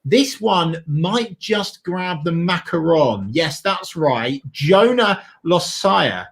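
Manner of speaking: slow, drawn-out big reveal; hyped-up presenter teasing news; dramatic announcer build-up. Acted. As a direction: tease the announcement with stretched, lifted words.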